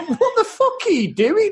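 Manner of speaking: high, laughing mock outrage; laughing disbelief. Mocking a silly film scene.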